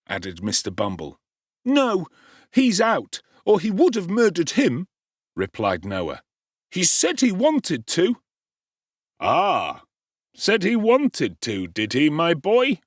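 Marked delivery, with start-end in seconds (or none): 1.7-8.2 put-on high, loud boyish whining; tattling whine. 9.2-12.8 raised, slow relish of outrage; eager gentleman. Acted. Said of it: "inquired the gentleman".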